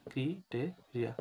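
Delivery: Criteria slowly, broken into syllables. Shown as a demonstration. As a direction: sound out criteria piece by piece for spelling. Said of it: "একটু বানানটা দেখিও". (Criteria ভেঙে ভেঙে, ধীরে)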